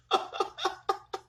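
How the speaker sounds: breathy high-pitched laugh; high airy giggle. Real, not acted. Mother laughing at the paint-covered sisters, airy giggle. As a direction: giggle at the silly mess.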